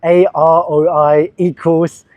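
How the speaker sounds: slow letter-by-letter spelling of the note; spelled out slowly. Shown as a demonstration. Spelling a food word out slowly for viewers. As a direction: read each letter out slowly, as if typing it. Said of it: "I'll probably pin it".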